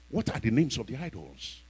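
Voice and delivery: ordinary delivery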